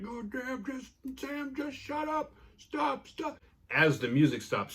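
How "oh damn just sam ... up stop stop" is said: very high, strained mock-desperate cry; desperate plea. Marty pounds his head, begging Sam to stop, in strained desperation. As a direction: plead in a squeezed, high-pitched voice.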